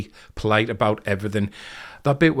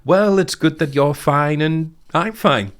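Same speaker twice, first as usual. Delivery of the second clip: slow, high-pitched imitation of a crumbling leader; Muffley; 6 semitones higher, 3 dB louder than usual. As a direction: placate feebly, drawn out and higher. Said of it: "he just crumbles".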